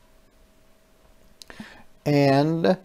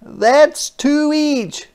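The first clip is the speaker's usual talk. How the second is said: put-on child's voice; 5 dB louder, much slower than usual. Kids counting their cookies.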